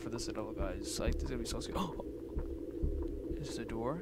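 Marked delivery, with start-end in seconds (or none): 1.8-3.3 soft, breath-only gasp; frightened gasp. Real, not acted. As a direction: suck in air, scared. Startled in horror game.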